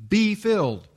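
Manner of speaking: high, loud command like a parent; firm command. Retold or imitated. Preacher quoting Paul's command, loud and firm. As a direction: bark it as an order to obey. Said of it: "In the same way you would say".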